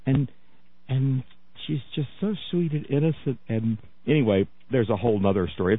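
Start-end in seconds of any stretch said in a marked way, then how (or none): 0.1-3.4 hushed imitation of a sugary-sweet wife; sweet, innocent wife. Host mimicking the preacher's drag-queen-like wife, soft and sweet. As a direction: play her softly, all sweet innocence. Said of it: "she talks like this".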